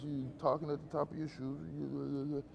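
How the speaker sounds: low, soft mumble; mumbled into the shoes. Shown as a demonstration. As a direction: talk down at your shoes, low and quiet. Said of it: "Pitch is whether or not".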